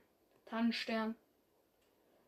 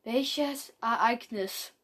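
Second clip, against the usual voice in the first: pressing; 7 dB louder, slower than usual. Acted. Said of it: "bohrte Tannenstern nach".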